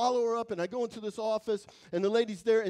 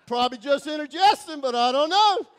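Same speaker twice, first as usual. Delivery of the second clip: loud, higher, hammed-up fretting; mock uneasy self-reassurance; 4 semitones higher, 12 dB louder than usual. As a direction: pretend it's nothing, clearly scared. Mock worried self-talk, chest pains.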